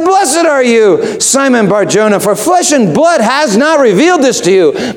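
ringing high-pitched proclamation; proclaimed. Shown as a demonstration. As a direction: lift it high and draw it out like a declaration. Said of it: "No. He said".

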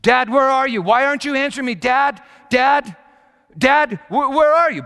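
imitating a lost teen, high and loud; son's anxious calling. Teenage son seeking his hidden dad, anxious calling. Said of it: "And they said".

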